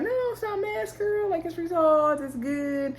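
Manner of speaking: high, light imitation of a friend; smooth it over in a put-on high voice; mock placating, way up high; high-pitched reassurance. Retold friend smoothing over the drama.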